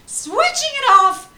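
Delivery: high, loud mock outrage; hammed-up furious declaration; mock-furious vow to turn it off. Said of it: "I'm like".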